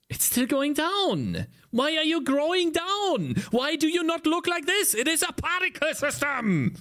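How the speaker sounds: venting at a game engine that won't cooperate; frustrated outburst. Real, not acted. Streamer scolding his software in exasperation. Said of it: "Why do I get so angry".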